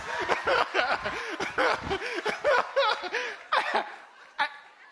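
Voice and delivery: loud high laughter; real hearty laughter; big high laugh. Real, not acted. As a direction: laugh hard at the memory. Big laugh at own story.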